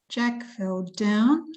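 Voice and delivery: slow, "down" stressed with a rise; slow demo stress on "down". Shown as a demonstration. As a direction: lean on "down", slowly. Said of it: "Where is the emphasis".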